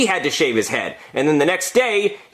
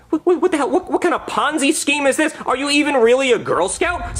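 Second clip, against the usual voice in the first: high, stammering indignation; incredulous, high-pitched accusation; outraged disbelief; 10 semitones higher than usual. Acted. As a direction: sputter in mock fury. Acted outrage at Girl Scout.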